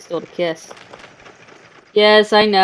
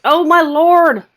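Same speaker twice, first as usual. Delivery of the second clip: surprised; 7 semitones higher, 7 dB louder than usual. Real, not acted. Cat does something.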